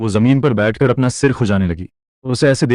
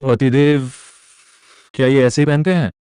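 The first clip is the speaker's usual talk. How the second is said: cute voice; 3 semitones higher than usual. Acted. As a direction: ask like a naive girlish ghost. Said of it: "cute सी आवाज में पूछा".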